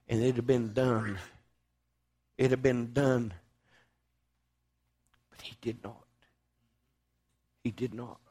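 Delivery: low, soft and spaced out; solemn hush. Real, not acted. Preacher reflects that Christ held back, in a solemn hush.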